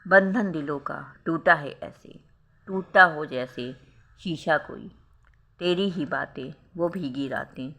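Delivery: slow, lingering recital of a heartbreak verse; poem recital. A narrator's reading. Narrator recites the heroine's heartbroken verse, slow and sad. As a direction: let each line hang, slow and wistful. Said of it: "वह मन ही मन सोचने लगी".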